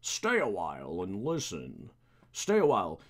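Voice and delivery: drawn-out old storyteller impression; put-on sage voice, drawn out; Deckard Cain. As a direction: stretch it like a game's wise elder. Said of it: "Oh, like Deckard Cain".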